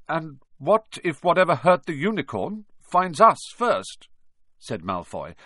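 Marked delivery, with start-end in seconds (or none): 0.1-4.1 put-on high, loud, frightened boy; fearful. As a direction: fear leaking through. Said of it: "unable to keep the fear out".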